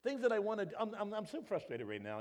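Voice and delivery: loud, high exasperated Paul; Paul venting his frustration; Paul frustrated. Retold or imitated. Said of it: "Paul says".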